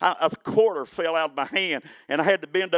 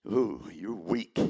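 low, slow, soft mocking self-talk; a lightly voiced gloomy inner critic; his own doubting voice; 6 semitones lower, 5 dB quieter, much slower than usual. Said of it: "That's [name] talking".

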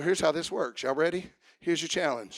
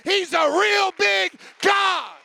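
loud, higher chanted refrain, slowing at the end; full-force preaching; 9 semitones higher, 6 dB louder than usual. Acted. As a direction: drive the refrain home, half singing.

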